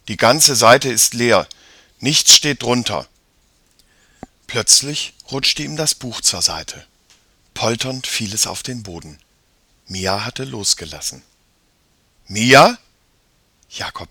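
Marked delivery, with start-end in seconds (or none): 0.1-3.1 loud, emphatic protest; puzzled boy. A narrator's reading. Jakob finds nothing under the heading, baffled. 12.3-12.8 loud call with rising pitch; calling out questioningly. A narrator's reading. Brother looks for his vanished sister, calling out. Jakob calls for missing sister.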